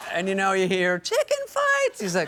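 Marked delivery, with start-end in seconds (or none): 1.0-2.0 very high, drawn-out gleeful call; mock party shout. Women at the pool interrupting the sad talk, imitated excited shriek. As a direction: squeal it like a distant pool reveller.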